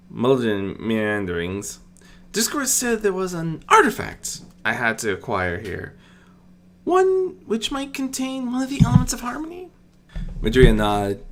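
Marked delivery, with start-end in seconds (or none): 2.3-9.7 higher, lightly voiced princess asking; gently inquiring royal, pitched up; Celestia's questioning voice. Princess asks the goddess about the artifact, a higher curious voice.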